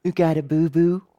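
a hint of a small child asking; little girl voice. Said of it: "I hear a little voice go".

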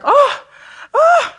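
high, loud hammed-up TV moan; mock moaning. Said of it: "those women would moan like".

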